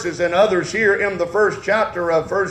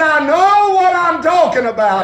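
fiery preaching; 4 semitones higher than usual. Acted. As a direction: preach it full force to the congregation. Preaching at full force.

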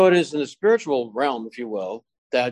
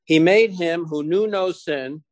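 slow, higher-pitched scripture reading; 4 semitones higher, slower than usual. Real, not acted. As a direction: read the verse out slowly and deliberately. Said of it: "I'll read it real slowly here".

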